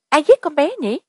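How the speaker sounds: high-pitched frightened question; terrified. A narrator's reading. A girl asking who killed the child, terrified. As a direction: voice lifted high with horror. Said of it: "lộ rõ sự kinh hoàng".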